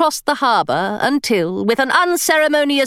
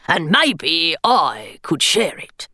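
gargoyle voice; 6 semitones lower, slower than usual. Acted. Gargoyle character voice.

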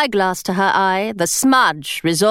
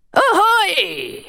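a higher, ringing pirate call; pirate's cry; 6 semitones higher than usual. Acted. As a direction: call it across the water like a pirate. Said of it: "he cried".

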